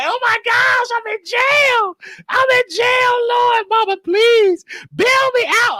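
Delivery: high, wailing plea to mama; mock crying. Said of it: "At first, I was crying".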